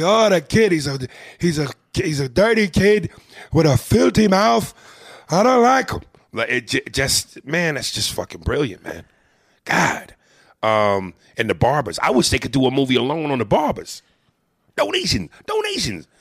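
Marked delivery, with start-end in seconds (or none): 0.0-6.0 put-on high, loud old man's grumble; cranky old man voice, raised high and loud. 14.8-16.1 very high squeaky call; quoted film preacher, pitched way up; mock preacher. Quoting a character from the film.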